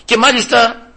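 high, loud pulpit voice; preaching at full force; fiery preaching. Acted. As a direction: rouse the congregation.

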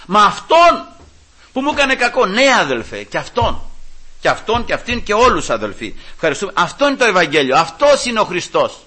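loud, insistent pulpit exhortation; impassioned preaching. Real, not acted. Preacher insisting on helping even enemies, impassioned.

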